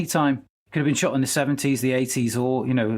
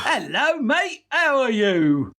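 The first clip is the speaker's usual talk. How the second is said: bad fake English accent; 12 semitones higher, 4 dB louder than usual. Friend mocking bad American-English accents, fake English accent. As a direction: overdo a cheesy English accent. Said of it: "American actor trying to do an English accent".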